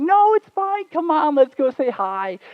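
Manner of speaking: very high, loud mock reassurance; insistent coaxing. Boyfriend coaxing her out of the car, high-pitched insistence. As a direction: wheedle in a squeaky high voice, drawing it out.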